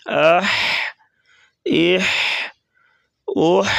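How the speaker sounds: اح said with a throaty ح; from the throat. Shown as a demonstration. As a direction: push اح from the throat. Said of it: "گلے سے آواز نکالیں گے".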